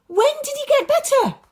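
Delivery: fast, high, loud and breathless; eager question. Acted. Father eager to know when his son recovered, excited. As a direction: rush the question out. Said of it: "the man asked".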